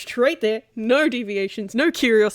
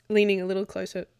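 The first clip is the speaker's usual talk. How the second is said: ordinary delivery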